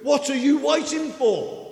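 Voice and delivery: loud, high, slow shout; excited shout. Acted. Preacher voicing Ananias to Paul, excited shout. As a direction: bellow the question as Ananias. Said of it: "Sorry, me shouting. I'm excited".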